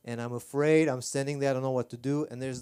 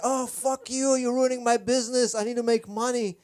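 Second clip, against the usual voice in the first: much higher, louder indignant comeback; angry retort; 10 semitones higher, 6 dB louder than usual. Retold or imitated. Taxi driver snapping back at the man who spoiled his fare, high angry retort. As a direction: fire back, offended and defensive.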